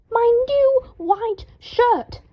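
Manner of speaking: very slow, high, loud wail; very slow, high anguished wail. A narrator's reading. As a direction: wail in despair over the shirt. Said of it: "Draco wailed".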